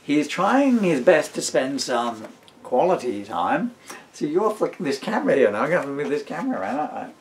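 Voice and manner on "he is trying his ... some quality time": put-on storybook reading in the baby's diary voice; reading aloud. Dad reading his book to his son, lightly voiced story reading. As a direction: read the diary entry out with a dry wink.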